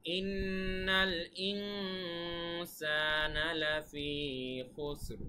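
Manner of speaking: slow Quranic chant; Quran recitation. Shown as a demonstration. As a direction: draw out each phrase like recitation.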